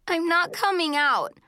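high, crying refusal; tearful. A narrator's reading. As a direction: refuse as if still in tears. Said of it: "still crying".

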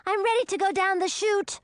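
high-pitched excited little dragoness; small, high, childlike excitement; excited cry. A narrator's reading. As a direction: pipe up eagerly, like an impatient child. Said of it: "her eyes wide with excitement".

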